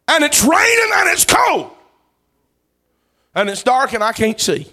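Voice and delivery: high, loud, fervent preacher's cry; loud revival preaching; preaching at full force. Acted. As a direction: preach it out to the congregation. Preaching the shipwreck story.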